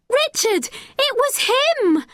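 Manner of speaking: put-on girl crying out to the boy; cried out. A narrator's reading. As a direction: make him believe her. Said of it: "Becky cried".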